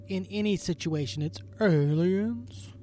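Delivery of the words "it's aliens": very slow, stretched-out punchline; drawn-out mock mystery. Teasing co-host about his usual theory, drawn-out mock mystery. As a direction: drag out "aliens" for comic effect. Mock catchphrase answer for everything.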